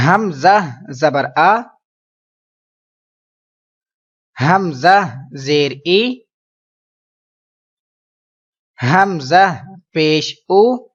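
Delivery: slow, drawn-out syllable-by-syllable recitation; slow spelling drill. Shown as a demonstration. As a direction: chant each letter slowly for the class to repeat. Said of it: "آپ میرے پیچھے repeat کرتے جائیے".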